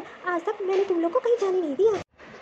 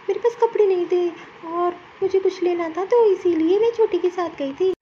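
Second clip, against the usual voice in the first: ordinary talk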